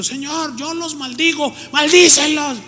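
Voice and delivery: high, hammed-up pulpit curse; mock cursing pastor. Preacher mocking a pulpit curse, hammed-up and high. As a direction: parody a pastor calling down curses. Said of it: "pastores que oran en el púlpito".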